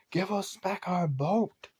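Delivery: high, loud yell across the water; pirate yelling. Acted. Pirates yelling at Percy's ship, high loud yell. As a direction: bellow it after the departing ship. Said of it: "yelling at the top of their lungs".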